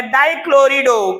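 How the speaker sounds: डाइक्लोरिडो drawn out slowly, syllable by syllable; slow model term. Shown as a demonstration. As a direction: teach the term डाइक्लोरिडो, stretching it out. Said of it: "क्या बोलेंगे". (डाइक्लोरिडो को धीरे-धीरे खींचकर बोलें)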